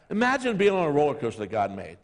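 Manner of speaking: ordinary delivery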